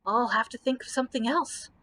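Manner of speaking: plain reading voice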